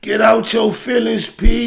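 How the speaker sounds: high, loud heckle; loud chiding. Real, not acted. Host heckling [name] over his reaction, loudly chiding.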